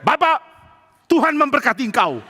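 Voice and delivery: high-pitched retold call-out, no real shouting; mock yell turned blessing. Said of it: "akhirnya dia teriak". (menirukan teriakan, nada tinggi)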